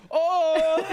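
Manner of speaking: high, laughing little oh; laughing gasp. Retold or imitated. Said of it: "the guy's just like".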